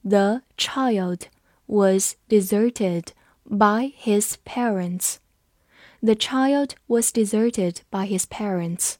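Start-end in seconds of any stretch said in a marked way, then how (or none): 0.0-5.2 very slow, word-by-word model reading; slow read-along. Shown as a demonstration. Teacher leads students through the sentence, slow and separated. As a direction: space out every word for learners to follow. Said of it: "跟着我慢读一遍".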